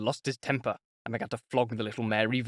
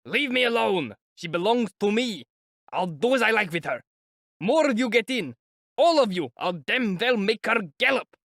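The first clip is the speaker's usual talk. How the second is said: put-on drunken defiance; 9 semitones higher than usual. A narrator's reading. Mikolka rants back at the crowd, drunken angry shouting. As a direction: bellow that she's yours and you'll do as you like.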